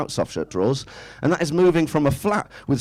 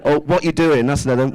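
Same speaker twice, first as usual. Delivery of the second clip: ordinary delivery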